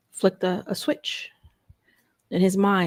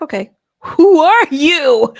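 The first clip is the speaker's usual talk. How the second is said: slow, loud, higher call of "who are you"; loud challenge; 9 semitones higher, 13 dB louder, much slower than usual. Retold or imitated. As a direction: call out from the stall, drawing it out. Calling out to the yelling man.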